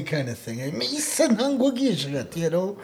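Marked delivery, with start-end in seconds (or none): 0.7-2.3 high-pitched emphatic proclamation; expressive declaration. Shown as a demonstration. Teacher demonstrates putting expression behind it, high declaration. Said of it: "put those expressions behind it".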